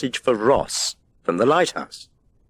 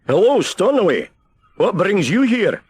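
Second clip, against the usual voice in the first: lighthouse keeper; 11 semitones higher, 5 dB louder than usual. A narrator's reading. Keeper greets the seagull.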